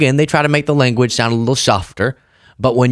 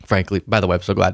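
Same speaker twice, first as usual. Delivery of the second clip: translated spin voice; 5 semitones lower, 4 dB quieter than usual. Retold or imitated. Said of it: "it spits out".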